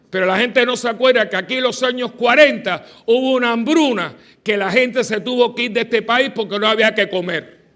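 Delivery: loud, high emphatic preaching; fiery preaching. Acted. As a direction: preach it to the congregation with fire. Preaching at force.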